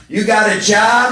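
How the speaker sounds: loud, slow mock shout across cars; sponsor's teasing shout. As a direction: yell it from the next car. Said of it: "My sponsor shouts out".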